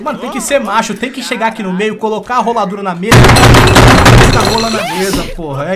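loud, fired-up rant; shouting. Real, not acted. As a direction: bellow it like a pep talk. Hyped rant to teammates.

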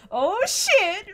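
high startled cry; startled shout. Real, not acted. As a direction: yelp it in shock. Jump scare in game.

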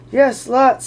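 loud high quick blurt; eager blurt. Acted. Bilbo to the trolls, a loud high blurt. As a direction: blurt it before thinking.

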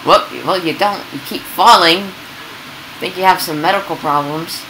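ordinary delivery

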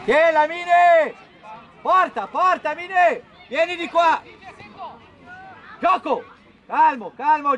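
urgent match shouting; shouted coaching calls. Real, not acted. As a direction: call instructions across the pitch. Coach calling to players mid-match.

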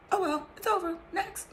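high-pitched "oh well", acted out; lightly mocking, high and carefree; breezy dismissal. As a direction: shrug it off in a high voice. Acting out shrugging off lost friendship.

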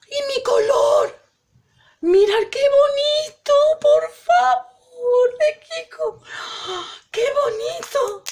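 gushing over a gift, voice cracking with emotion; overwhelmed, near tears. Real, not acted. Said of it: "sabía que no me iba a aguantar".

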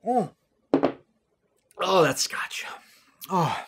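breathy whispered aside to himself; just breathe it out as a private aside. Real, not acted. Sipping a drink after the clip.

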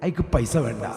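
ordinary delivery

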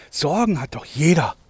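normal talking voice

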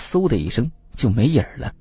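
plain reading voice

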